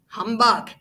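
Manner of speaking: gruff, loud "Humbug!"; scornful humbug. Acted. Scrooge stuck for an answer, gruff humbug. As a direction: growl it out in a cranky old voice. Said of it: "followed it up with".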